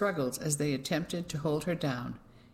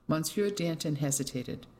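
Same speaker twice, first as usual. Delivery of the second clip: ordinary delivery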